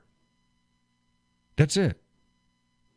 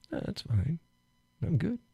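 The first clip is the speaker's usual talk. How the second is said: much softer, breezy reply; quiet mock calm; 4 semitones higher, 9 dB quieter than usual. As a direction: brush it off softly, faking calm. Quiet retort.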